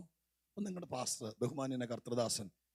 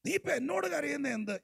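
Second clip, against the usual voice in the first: much higher, a little louder, stress on ഇപ്പൊ; sharp rebuke; 12 semitones higher, 4 dB louder than usual. Retold or imitated. Pastor restating God's rebuke to Moses, pointed. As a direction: repeat the rebuke, lighter than the shout. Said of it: "അടുത്ത വാചകം".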